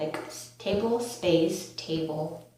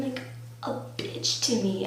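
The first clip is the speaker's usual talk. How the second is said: usual speaking voice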